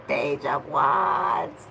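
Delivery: slow, laughing repeat. Real, not acted. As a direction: laugh it out slowly. Repeats card name, laughs.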